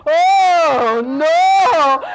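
loud, long swooping wail; moaning bear. A narrator's reading. Listeners join the moaning bear, loud moan. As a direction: moan it loud, drawing out each word. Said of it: "and he was moaning".

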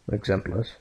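"Exemples" repeated quietly the Catalan way; Catalan pronunciation. Shown as a demonstration. Teacher repeats the Catalan word for the class. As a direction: echo "Exemples" softly in Catalan. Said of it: "as they're called in Catalan".

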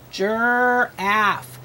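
"Giraffe" split into jir and aff; "Giraffe" slowly blended from its sounds; sounding out. Shown as a demonstration. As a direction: stretch the er in "Giraffe", then add aff. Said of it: "I R says er".

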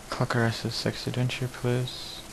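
ordinary talk

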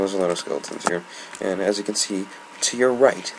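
usual speaking voice

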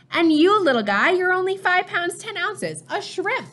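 high, doting voice for a tiny newborn; father's high baby-talk voice to the newborn twin; special baby voice. Acted. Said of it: "That's the special voice he uses with Gabriel".

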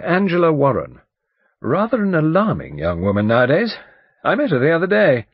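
loud, brisk barrister recalling names; blustering lawyer, chatty. Acted. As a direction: chat loudly like a self-assured old lawyer.

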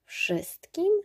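pytanie wysoko w górę, z niedowierzaniem; zdumione pytanie. A narrator's reading. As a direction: nie dowierzaj ogromowi władzy. Prince astonished. („Wszystkim?” wyrzucone wysoko, w zdumieniu)

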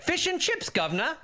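high, hammed-up fake British accent; a mock Cockney British accent; bad British accent. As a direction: ham up a cartoon British accent. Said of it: "they hate it when I do my British accent".

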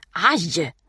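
short, loud narrated yelp; cry of pain. A narrator's reading. Boy bitten by Putte, crying out in pain. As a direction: yell out as if bitten. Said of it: "skriker pojken".

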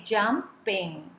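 "jumping" slowly split as jum, ping; two separate beats on "jumping"; syllable by syllable. Shown as a demonstration. Teacher showing how to count syllables, "jumping" split. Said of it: "dividing the word into".

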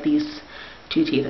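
talking with finger on lip; finger pulling lip down. Real, not acted. Seen 4: Finger presses lower lip down at 3.4–4.4s, showing braces while talking.

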